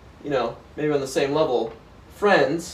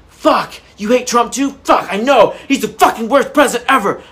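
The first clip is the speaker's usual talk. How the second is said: mock bitching; 7 semitones higher, 7 dB louder than usual.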